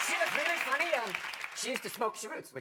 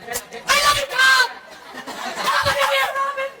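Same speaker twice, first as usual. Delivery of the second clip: much higher, louder screaming fan; 12 semitones higher, 4 dB louder, much slower than usual. Acted. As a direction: shriek it like a starstruck fan. Screaming fan at Tom Cruise.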